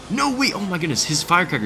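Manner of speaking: high, startled "No, wait"; sudden alarm at the damage; alarmed surprise. Real, not acted. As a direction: caught off guard mid-match. Enemy Firecracker keeps dealing damage.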